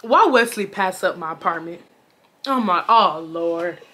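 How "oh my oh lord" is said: swooning. Retold or imitated. Swooning at Wesley's arrival.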